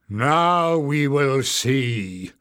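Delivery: slow drawn-out sneer. A narrator's reading. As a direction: sneer it out slowly, gloating. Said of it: "sneered Warren".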